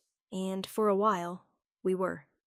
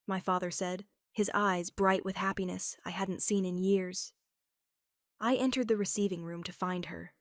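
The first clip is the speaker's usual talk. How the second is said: ordinary delivery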